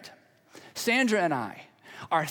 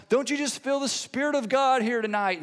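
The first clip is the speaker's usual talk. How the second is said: high, gushing revival-preacher hype; mock spiritual fervor, pitched up; 5 semitones higher than usual. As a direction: put on a hyped-up churchy invitation. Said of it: "every little bit of hype".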